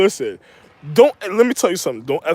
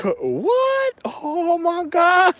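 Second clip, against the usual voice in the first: mock shock; 10 semitones higher, much slower than usual.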